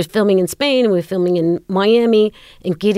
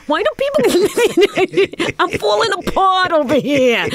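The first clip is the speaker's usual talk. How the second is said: very high, drawn-out comic wail; hammed-up meltdown, pitched way up; retold frantic despair; 12 semitones higher, slower than usual. Falling apart when told she's doing good work, frantic.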